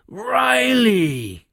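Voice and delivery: roaring tyrant king. Acted. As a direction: call over the hammering. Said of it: "he bellowed again".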